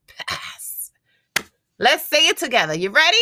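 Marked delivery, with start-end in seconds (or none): 0.1-0.9 breathy whisper for the final word; hushed whispered ending; whispered pass. Acted. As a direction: drop to a whisper to land it.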